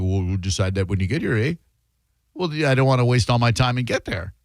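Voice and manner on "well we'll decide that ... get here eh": put-on Canadian accent. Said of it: "They go".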